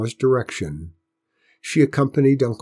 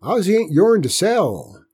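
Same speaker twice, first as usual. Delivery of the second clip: put-on firm high old man; old grandpa voice; 8 semitones higher, 6 dB louder than usual. A narrator's reading. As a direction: stand your ground, old farmer.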